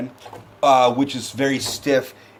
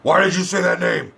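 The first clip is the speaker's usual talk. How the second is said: put-on voice, raised mock-angry demand; Batfleck; 4 semitones higher than usual. Reviewer mimics Batman losing it at Superman, mock-angry demand. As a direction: demand it in a hammed-up hero voice. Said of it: "fucking Batfleck loses his shit".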